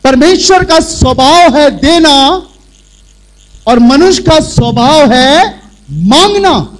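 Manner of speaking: loud, slow sermon delivery from the pulpit; forceful preaching. Acted. Preacher opens the sermon to the church, loud and forceful. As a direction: proclaim it to the whole congregation.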